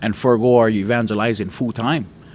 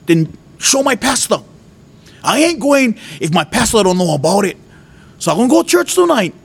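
yelling at God; 13 semitones higher than usual. Retold or imitated. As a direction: demand it of God, upset and near tears. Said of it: "I'm yelling in my house, crying".

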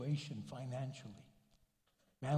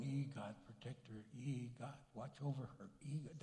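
mumbled prayer; 4 semitones lower, 10 dB quieter than usual. Retold or imitated. Father's quick private prayers.

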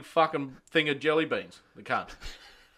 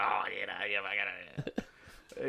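sheepish mumble; 5 semitones lower, 8 dB quieter than usual. Retold or imitated. Workmate caught out, stammering excuse.

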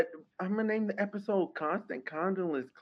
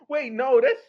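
normal talking voice

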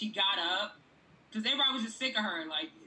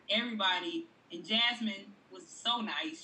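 ordinary talk

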